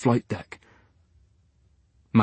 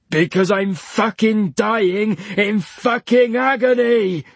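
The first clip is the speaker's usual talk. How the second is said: higher, slow furious roar; 8 semitones higher, slower than usual. A narrator's reading. Leach roaring at Diana in agony. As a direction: bellow it in pain. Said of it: "roared Leach".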